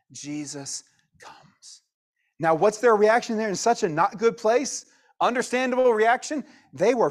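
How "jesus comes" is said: a slow breathless hush; slow reverent whisper of the arrival; slow awed whisper. Acted. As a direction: whisper it slowly, like a reveal. Hushed reveal of Jesus arriving.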